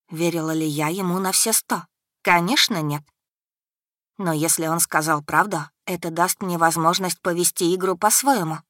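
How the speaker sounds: plain reading voice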